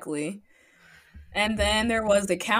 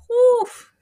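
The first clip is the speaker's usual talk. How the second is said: excited; 9 dB louder than usual. Real, not acted. Reacting to idols looking good.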